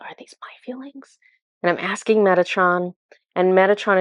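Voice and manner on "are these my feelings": breathy whispered question; a hushed private question. Retold or imitated. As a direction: whisper it to yourself, unsure. Inner question repeated softly.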